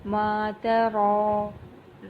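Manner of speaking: تری with ra held one alif; long alif. Shown as a demonstration. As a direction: stretch ra in ما تری. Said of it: "کھڑی زبر ہے ... ایک الف کے برابر کھینچ".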